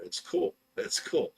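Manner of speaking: breathy whispered it's cool, letting it go; mock whispered brush-off. Telling his angry friend to drop it, in a whisper. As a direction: whisper it like it doesn't matter.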